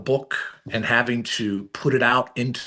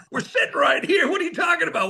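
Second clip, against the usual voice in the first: much higher, laughing mock shout; laughing protest; faster than usual. Sons at the table answering mom, laughing mock protest.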